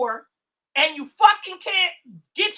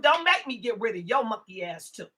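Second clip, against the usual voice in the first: normal talking voice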